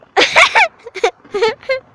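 high, loud burst of laughing; big high cackling laugh; loud laughter. Real, not acted. Laughs after the clown scare.

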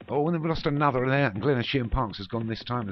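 high burst of dismay, easing off; startled exclamation. Real, not acted. Commentator reporting another faller, startled. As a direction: call the sudden loss with alarm. Another horse falls mid-race.

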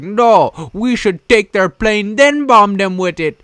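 high, loud caricature voice; crazy plotter. Acted. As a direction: put on a cartoonish plotter's voice. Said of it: "one guy was like".

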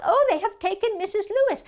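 high-pitched cry of alarm. Acted. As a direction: cry out that a friend is taken. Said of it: "A woman cried out".

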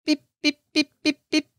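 high, sharp beep beep beep; mimicking a carp alarm beeping; imitated bite alarm. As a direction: sound out the alarm going off.

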